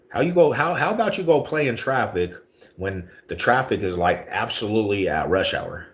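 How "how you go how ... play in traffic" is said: raised mocking clapback. Real, not acted. Clapping back at a chat troll.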